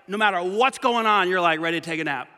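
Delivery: ordinary delivery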